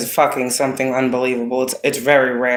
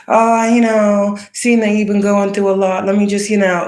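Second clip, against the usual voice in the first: fake sweet helper; 6 semitones higher than usual. Mocking fake helpers who want back in, in a sugary voice. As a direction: put on a phony caring voice to mock a user.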